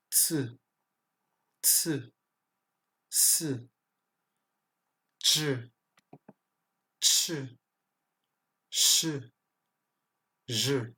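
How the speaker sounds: "ts, ts, s, je, ch, che, je", each ending in French e; isolated syllables "ts, s, je, ch, che", buzzed e vowel. Shown as a demonstration. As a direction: swap i for a schwa-like e after "ts, s, je, ch". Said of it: "se prononce comme le son E".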